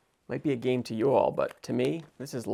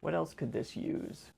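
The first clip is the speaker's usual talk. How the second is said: quiet inner thought; 4 semitones higher, 9 dB quieter, slower than usual. Retold or imitated. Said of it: "I'm thinking".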